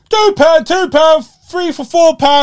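high, loud hawker calling out prices; market trader's cry. Retold or imitated. A market trader calling out to passing shoppers, loud and high. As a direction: bellow the deal like a stall trader. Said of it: "You can hear one go".

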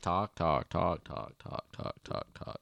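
rapid repeated chatter fading to a murmur; imitating a child chatterbox. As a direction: parrot an endless talker, trailing away to nothing. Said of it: "I'm gonna be like you".

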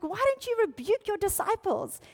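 high-pitched mock indignation; furious. Religious rulers telling Jesus to rebuke disciples, furious. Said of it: "they are furious".

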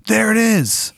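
high-pitched excited call; soldier calling out. Acted. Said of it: "and they go".

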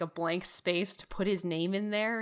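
ordinary talk, nothing marked

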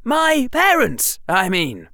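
high hurried fix; hasty correction. A narrator's reading. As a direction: scramble to fix the slip. Harry corrects himself.

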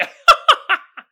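big high laugh; loud burst of laughter. Real, not acted. Cracking up at his own monster joke, loud high laugh.

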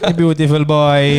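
slow, loud mock-Italian gushing; Italian accent. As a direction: lay on a fake Italian accent, stretched out. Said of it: "You do an Italian voice there".

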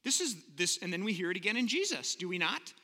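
normal talking voice

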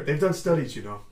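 usual speaking voice

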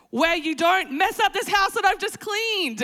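high, loud comic scolding; exasperated parent. Acted. Mother protecting her cleaned house, high and exasperated. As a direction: plead loudly, climbing in frustration.

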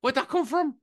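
high, startled double question; surprised wife. Retold or imitated. Wife startled by her husband swearing at the game, high surprised question. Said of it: "And she's like".

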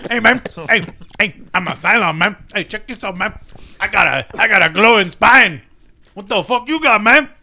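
put-on sing-song Chicano stoner accent, swinging pitch; Cheech Marin impression. Comic as Olmos bragging about glowing spine, exaggerated Chicano accent. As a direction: play a Chicano stoner boasting, vowels stretched. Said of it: "will be played by Cheech Marin".

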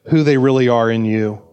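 normal talking voice

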